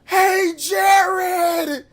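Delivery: high, loud crying shout; alarmed call. Retold or imitated. Friend shouting to Jared that he is here, high and loud. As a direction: yell out to warn Jared.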